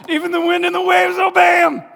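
high loud line shouted through laughter; laughing as he voices the frightened disciples. Retold or imitated. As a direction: blurt it out while cracking up. Quoting disciples while laughing.

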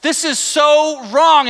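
high, loud and slow, scolding; indignant rebuke. Retold or imitated. Paul rebuking the Galatians, high indignant rebuke. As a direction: lash out at the flock. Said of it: "he is saying".

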